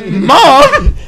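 hoher, überraschter Ausruf; startled squeak. Retold or imitated. Retold surprise at mom's question.

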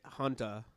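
"Hunter" with the final R dropped. Shown as a demonstration. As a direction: say "Hunter" ending on a soft "-uh".

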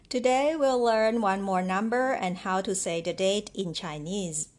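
ordinary talk

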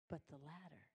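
hushed. Acted. God's answer, spoken low.

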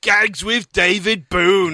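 slow, drawn-out segment announcement; announcer voice. Acted. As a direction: present the title like a show host.

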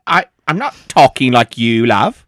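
put-on low-pitched, accidental British accent; denying it in a copied British accent; mimicked British accent. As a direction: slip into the singer's British accent. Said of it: "Why are you talking like that?".

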